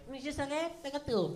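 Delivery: mock a wimpy French dub; lightly voiced feeble Terminator; weak French dub. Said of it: "he's like".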